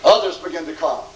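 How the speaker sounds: ordinary talk, nothing marked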